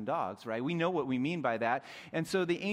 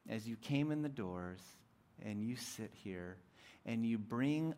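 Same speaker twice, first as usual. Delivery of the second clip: soft, intimate altar-call voice; quiet preaching; 4 semitones lower, 7 dB quieter than usual. Acted. Preacher gently asking the congregation, soft and intimate. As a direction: draw each listener in softly.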